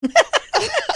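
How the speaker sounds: high squealing laugh; loud laugh. Real, not acted. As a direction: burst out laughing at the memory. Mother starstruck before Steve Harvey.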